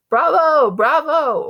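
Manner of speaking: high, loud bravos; cheering. A narrator's reading. Teacher clapping for Justin and Via, cheering. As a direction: hail the performers with big acclaim.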